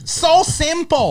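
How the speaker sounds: high and very slow stretched echo; mocking echo. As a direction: drag it out high and silly. Repeating friend's line mockingly.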